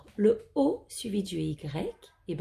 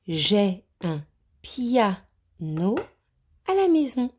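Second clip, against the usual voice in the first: slow emphatic reading; 2 semitones higher, 3 dB louder, much slower than usual. Shown as a demonstration. Teacher reading a sentence to the children with a bright ending. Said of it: "teacher reads a sentence emphatically".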